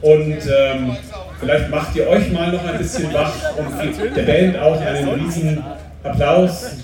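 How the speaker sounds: normal talking voice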